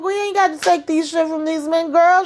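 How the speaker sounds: high, sassy imitation; rally her in a high voice; mock fired-up rant. Danny preaching to Mindy, quoted.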